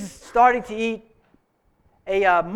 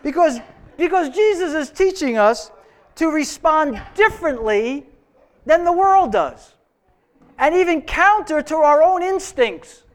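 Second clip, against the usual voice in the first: raised, higher and insistent; preaching; 6 semitones higher, 3 dB louder than usual. Real, not acted. Preacher answering the congregation's groan, higher and louder. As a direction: press the point to the congregation.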